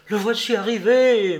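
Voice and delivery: high, loud cry of alarm; urgent loud warning shout. A narrator's reading. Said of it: "m'écriai-je".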